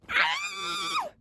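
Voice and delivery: sharp high gasp of disbelief; high shocked gasp. Real, not acted. Reader reaches the plot twist, high gasp.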